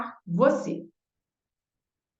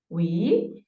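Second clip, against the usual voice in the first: ordinary delivery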